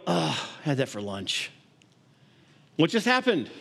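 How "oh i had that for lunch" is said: lower, softer, offhand brush-off; dismissive husband. Retold or imitated. Husband coming home, unimpressed by the fish dinner, dismissive and lower. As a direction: shrug it off like it's nothing special. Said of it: "And you go".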